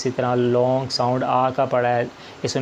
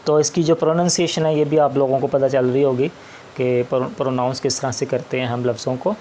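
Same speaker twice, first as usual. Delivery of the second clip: normal talking voice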